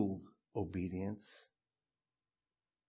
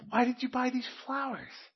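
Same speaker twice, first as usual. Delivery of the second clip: excited wife; 10 semitones higher than usual. Retold or imitated. Wife voiced in illustration.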